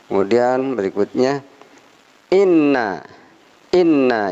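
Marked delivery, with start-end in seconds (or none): 2.3-4.3 "inna" with a held doubled nn, stressed. Shown as a demonstration. Teacher shows tasydid in "inna". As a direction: press and hold the nn in "inna". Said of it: "ini ada tasdid".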